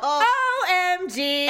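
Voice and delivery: slow, high, spelled-out exclamation; long, stretched, high-pitched dismay; aghast. Real, not acted. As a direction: drag out each letter in disbelief. Friend aghast at the story.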